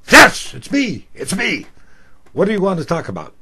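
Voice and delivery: eager host. Retold or imitated. Host eager on air with caller.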